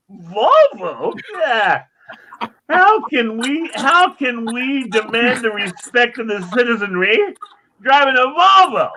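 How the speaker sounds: high, slow, indignant whine; outraged rookie cop. Retold or imitated. Rookie cop complaining to the sergeant about Volvos, high indignant whine. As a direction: pitch it way up and drag it out in disbelief.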